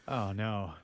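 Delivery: normal talking voice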